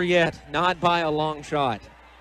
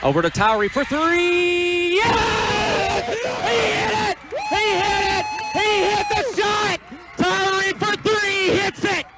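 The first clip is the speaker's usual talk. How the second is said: screaming the game-winner call, high and slowed; roaring high-pitched radio call; excited play-by-play call; 14 semitones higher, slower than usual. Real, not acted. Buzzer-beater three, radio call.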